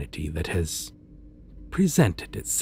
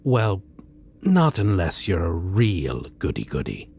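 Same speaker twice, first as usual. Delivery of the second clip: ordinary talk, nothing marked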